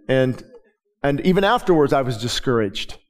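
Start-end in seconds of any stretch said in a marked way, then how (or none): none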